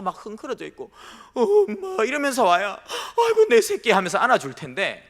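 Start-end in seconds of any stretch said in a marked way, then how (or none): 1.3-2.0 put-on high, loud pitiful child's cry of mom; a lost little kid sobbing for his mother; mock wailing child. A preacher imitating a filthy lost child calling out to mom. 3.2-3.9 high, loud laughing cry of relief; hammed-up mother scooping up her baby; mock relieved mother. A preacher playing a mother hugging her found child with a laughing cry.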